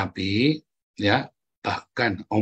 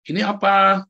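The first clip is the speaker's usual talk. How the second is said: teacher's question; 9 semitones higher, 5 dB louder than usual. Retold or imitated. Teacher asks child in story.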